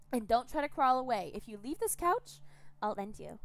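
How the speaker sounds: high, loud gloating taunts; gleeful cannibal captor. Acted. Captor gloating to Gregor about eating his limbs, high and gleeful. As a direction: relish his horror.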